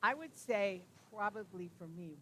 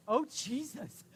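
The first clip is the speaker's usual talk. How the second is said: higher and louder, a startled "Oh, Jesus"; startled exclamation; 7 semitones higher, 7 dB louder than usual. Retold or imitated. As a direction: blurt "Oh, Jesus" in shock, loud and high. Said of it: "you know what I said?".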